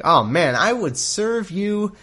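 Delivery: high, slow, swooning mock sincerity; lovestruck Jacob. Jacob pledging years of service for Rachel, mock-swooning high and slow. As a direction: sigh it out like a smitten suitor, hammed up.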